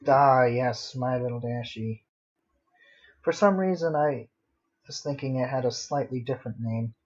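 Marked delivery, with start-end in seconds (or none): none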